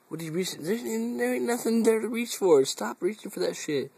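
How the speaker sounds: high singsong pet voice scolding the cat; high coaxing voice, teasing the pet; talking to the cat. Acted. Owner scolding her lazy cat Steve in a high pet voice.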